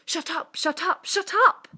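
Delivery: irritable rabbit losing it, building to a yell; angry shouting. A narrator's reading. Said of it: "irritable rabbit shouted".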